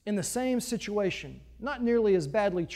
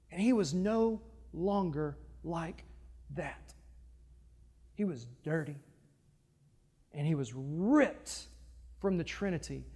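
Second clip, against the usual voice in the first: softer, lower, grave; hushed solemn; 5 semitones lower, 8 dB quieter than usual. Real, not acted. Preacher telling the congregation Jesus was torn away, hushed and grave. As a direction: drop the voice to a solemn hush.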